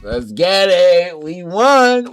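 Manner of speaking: loud, high victory whoop; excited cheer. Pretend. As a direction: cheer like you just won. Celebrating the big win.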